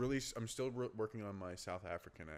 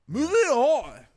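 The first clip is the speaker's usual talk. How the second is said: high, loud brisk brush-off; mock announcer; 20 dB louder, slower than usual. Real, not acted. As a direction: wave it away with a showy flourish. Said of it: "awkward joke brush-off".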